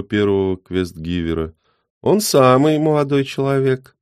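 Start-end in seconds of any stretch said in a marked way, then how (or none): 2.0-4.0 put-on high, louder old man voice; spry old man cheering himself up; perky old man. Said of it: "бодрится старичок".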